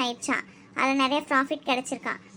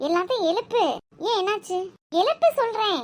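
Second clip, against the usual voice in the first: urgent urging; 7 semitones higher than usual. Acted. Urging to wake everyone.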